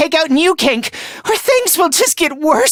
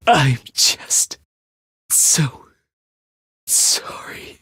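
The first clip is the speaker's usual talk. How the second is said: faltering, fading into a whisper; broken apology. Acted. Apologizing to Ava for what was done, broken. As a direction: let long pauses split the apology, voice giving out.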